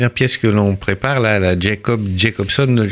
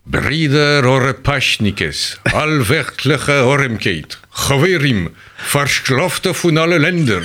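Soviet ambassador's Yiddish with a Russian accent, pitched higher; Litvak Yiddish, Russian accent; 6 semitones higher than usual. Shown as a demonstration. Actor demonstrates his ambassador role on request, Litvak Yiddish with Russian accent. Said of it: "le yiddish litvak avec l'accent russe".

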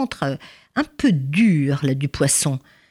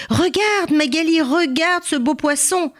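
excited call; 10 semitones higher than usual. Acted. Père montre le poisson à sa femme.

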